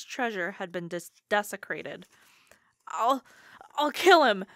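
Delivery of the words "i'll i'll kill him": high whiny threat, rising loud. Acted. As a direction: whine out the threat, voice climbing. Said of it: "Ferd whined".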